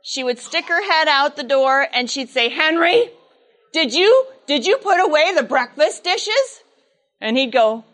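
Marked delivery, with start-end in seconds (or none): 2.5-6.6 mother calling out. Retold or imitated.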